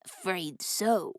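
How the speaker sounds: raspy voice. A narrator's reading. Raspy thug calmly answering the driver, soft and slow. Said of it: "the man with the raspy voice said".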